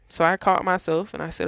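ordinary talk, nothing marked

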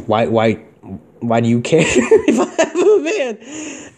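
high, slow, laughing mock outrage; mock indignant, laughing. Retelling his reply to his girlfriend about the van, high and laughing. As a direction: drag it out, cracking up. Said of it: "I'm like".

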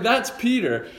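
ordinary talk

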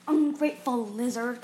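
loud snarled insult; a loud, snapped insult at Tsunami. A narrator's reading. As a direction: spit the insult out. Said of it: "Kestrel snapped".

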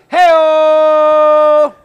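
long, high, blasting "hey-o"; loud call-out. Real, not acted. Co-host calling out for the guy in Sim six, loud holler. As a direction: holler it high and drawn out to the whole room. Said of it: "That was nice and loud".

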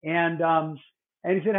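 ordinary delivery

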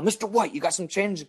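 lightly voiced pleading for spare change; mock panhandler. Imitates man begging for change.

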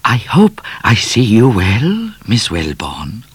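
a polite cat paying respects; lightly voiced suave cat; courtly cat. A narrator's reading. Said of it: "The cat bowed and said".

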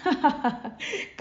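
high ha-ha-ha, acted; goddess's laugh. A narrator's reading. Kali laughing at Raman's trick, hearty laugh. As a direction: laugh heartily at being outwitted. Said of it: "காளி மீண்டும் சிரித்தாள்".

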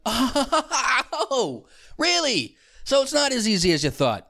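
very high, laughing "oh" into a taunt; mock gloating surprise. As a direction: rub it in with exaggerated surprise. Mocking reply to the woman.

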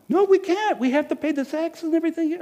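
high, earnest Joseph; pleading Joseph. Acted. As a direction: explain they're stuck here.

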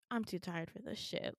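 soft, tired aside; weary resignation. Retold or imitated. Patient worn out by the nurse, weary resignation. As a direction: give up quietly.

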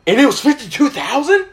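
high-pitched mock disbelief; acted-out alarmed question; nervous and scared. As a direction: imitate a panicked dad, voice climbing. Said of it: "so fucking nervous and scared".

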